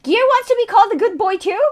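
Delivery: high, loud disbelief; incredulous outburst. Real, not acted. Streamer learns Gear wants praise too, incredulous. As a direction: burst out in astonished question. Astonished at viewer's request.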